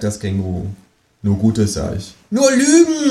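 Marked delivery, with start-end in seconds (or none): 2.3-3.1 high, loud outraged kangaroo; indignant cry. A narrator's reading. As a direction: shout it, scandalised. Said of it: "ruft das Känguru empört".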